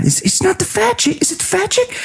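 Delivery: high, mocking sing-song of the question; mocking Alec. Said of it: "He goes".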